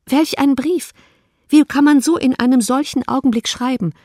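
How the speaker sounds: outraged reader of a shameless letter; indignant disbelief. Acted. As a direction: throw up your hands at the letter.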